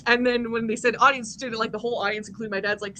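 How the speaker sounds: normal talking voice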